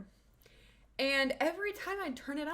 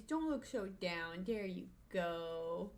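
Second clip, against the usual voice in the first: softer, slow reassurance; soothing the dog; 4 semitones lower, 6 dB quieter, slower than usual. Real, not acted. Owner softly soothing her dog, slow and tender. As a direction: settle him gently.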